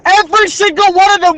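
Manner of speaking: very high-pitched incredulity; exasperated retelling. Real, not acted. Student describing clueless people on video, exasperated. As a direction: tell it in high-pitched disbelief.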